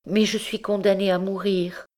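high, desperate confession; despairing girl. Acted. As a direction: admit she must die, distraught. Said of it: "la malheureuse enfant". (voix aiguë et désespérée)

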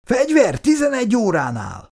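high-pitched urgent shout of alarm; warning shout. A narrator's reading. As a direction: cry out a sudden danger warning. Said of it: "Hallotta a kiáltást".

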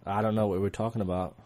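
a touch weary, slightly low; tired. Real, not acted. Co-host put on the spot, sounding mildly tired. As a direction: sound a little worn out. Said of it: "You sound tired".

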